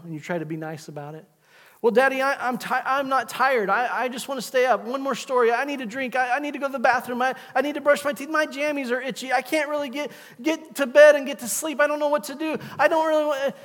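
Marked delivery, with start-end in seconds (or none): 1.8-13.5 put-on high, pleading kid voice, speeding up; mock child stalling; whiny child. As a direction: beg like a kid stalling bedtime. Child begging to stay up.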